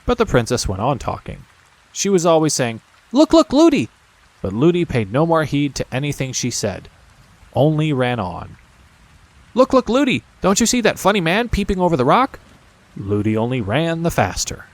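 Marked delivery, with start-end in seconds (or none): 3.1-3.9 put-on high excited child call; high eager little-girl call; princess calling. A narrator's reading. 9.5-12.4 put-on high, fast excited child; princess calling. A narrator's reading.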